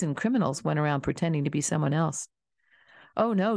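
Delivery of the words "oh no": higher, alarmed protest; a touch of panic in the refusal; cried in dismay. A narrator's reading. Girl refusing to remove her breeches, mild dismay. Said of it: "she cried in dismay".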